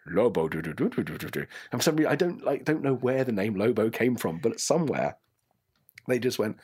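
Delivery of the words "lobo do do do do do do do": low mock-French nonsense patter; comic French-accent riff on nonsense syllables. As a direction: try out a rough French accent. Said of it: "Are you gonna be French?".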